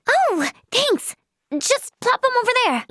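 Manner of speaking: high, breezy acknowledgement; cheery thanks. Acted. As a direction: toss it out cheerily, pitched high. Cheerfully thanks someone helping out.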